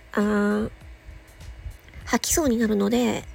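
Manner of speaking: ordinary talk, nothing marked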